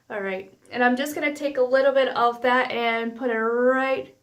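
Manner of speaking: ordinary talk, nothing marked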